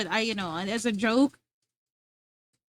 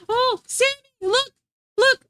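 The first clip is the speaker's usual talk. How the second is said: excited call; 11 semitones higher than usual. Real, not acted. Excitedly showing off to chat.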